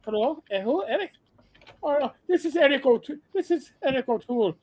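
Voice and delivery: comic Irish answering machine voice; Irish accent. Retold or imitated. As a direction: play an Irish host introducing his show. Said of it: "the Saint Patsy's one".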